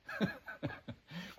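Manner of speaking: short self-amused laugh; amused chuckle. Real, not acted. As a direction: chuckle at your own anecdote. Amused by colleague's remark about sticky.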